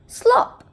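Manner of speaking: high, loud "Slop!" sound effect; splashy slop. A narrator's reading. Reading to kids, the bucket lands: a high, loud "Slop!". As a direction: pop it out like a splash.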